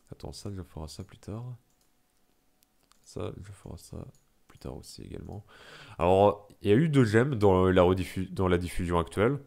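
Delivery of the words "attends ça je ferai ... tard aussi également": barely audible note to self; muttered aside. Real, not acted. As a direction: think aloud under your breath. Aside to self while playing.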